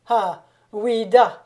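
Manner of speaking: loud sardonic jeer, a touch frightening; mocking, chilling jeer; sardonic and menacing. A narrator's reading. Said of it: "son accent sardonique avec quelque chose d'effrayant".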